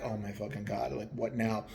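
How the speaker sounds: sinking, muttering under the breath; dread. Retold or imitated. Driver seeing the sign woman again, replaying a low muttered dread.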